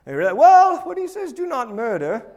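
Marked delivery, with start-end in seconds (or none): 0.4-1.3 high, loud put-on British voice; comic British quibbler, pitched way up; mock British accent. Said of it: "Marx wasn't British, by the way". 1.3-2.3 higher, put-on British voice; hammed-up British accent, quibbling over the wording; mock British accent. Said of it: "Marx wasn't British, by the way".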